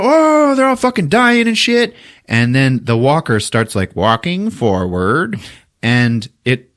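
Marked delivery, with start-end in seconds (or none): 0.0-2.0 high, loud mock dying squeal; mock panicked wail. Troopers mowed down, high hammed-up wail.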